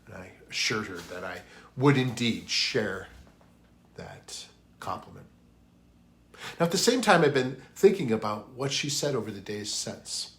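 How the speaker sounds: usual speaking voice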